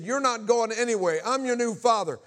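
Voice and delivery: high, loud imitation of an irate stepfather; retold angry assertion. Stepfather blocks boy on stairs, acted angry shout. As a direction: lay down the law, acted shout.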